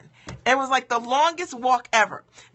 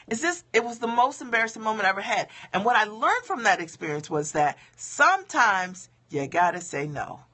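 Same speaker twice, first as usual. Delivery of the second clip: normal talking voice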